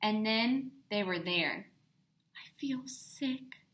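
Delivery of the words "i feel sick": a slow, much softer whisper; weakly. A narrator's reading. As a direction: barely get the words out, feeling sick. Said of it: "said Sarah weakly".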